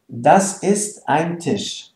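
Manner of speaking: slow, careful German for learners; teacher's slow demo; slow model sentence. Shown as a demonstration. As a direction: lay out each word slowly for the class.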